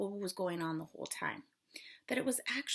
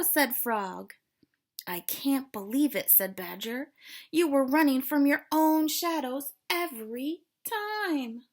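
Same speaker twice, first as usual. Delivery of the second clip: put-on expressive read-aloud for kids; storybook reading; 10 dB louder, slower than usual. A narrator's reading. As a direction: read it like a picture book to children. Teacher reads storybook to class.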